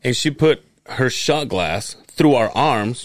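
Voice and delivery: ordinary talk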